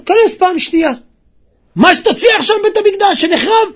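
high, loud and quick urging; loud, high-pitched command; shouted retold order.

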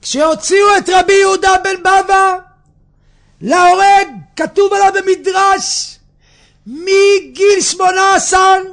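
high, loud declaiming to a congregation; full-force preaching. Acted. (דרשה בקול רם ונרגש)

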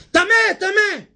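high loud call; high shouting leper. Acted. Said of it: "הוא ממשיך בענייניו".

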